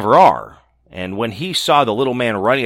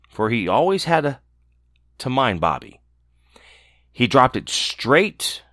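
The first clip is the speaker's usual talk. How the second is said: plain reading voice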